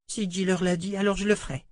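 put-on high child voice, trusting; a girl agreeing bravely; obedient little girl. A narrator's reading. Rachel obéit. (voix de fillette confiante)

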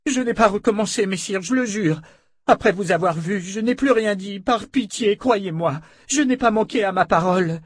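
high, pleading victim's voice; supplication affolée. A narrator's reading. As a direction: beg desperately to be believed. Captive begs her attackers to believe. (voix aiguë et suppliante)

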